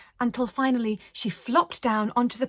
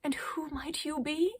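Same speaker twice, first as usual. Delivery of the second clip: soft, higher whispered question; tender whisper; 8 semitones higher, 5 dB quieter than usual. Acted. Arcadia strokes the child's cheek, whispered. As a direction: breathe it gently.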